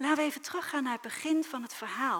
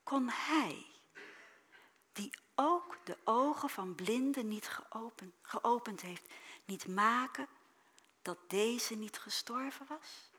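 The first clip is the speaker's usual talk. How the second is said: hushed doubt; 6 dB quieter than usual. Acted. Some doubt Jesus at the grave.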